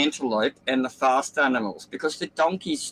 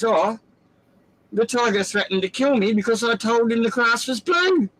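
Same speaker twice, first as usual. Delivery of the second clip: higher, whiny tattletale donkey; 8 semitones higher, 3 dB louder than usual. Acted. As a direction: complain to the king like a wronged donkey. Donkey complains to the lion.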